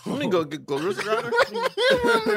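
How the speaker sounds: high slow laughing voice. Real, not acted. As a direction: laugh through the words. Joking, laughing mid-line.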